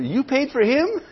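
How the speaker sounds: high, amused incredulous question; mock surprise. Teacher teasing a woman who paid for both, mock surprise. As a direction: act astonished, teasing her.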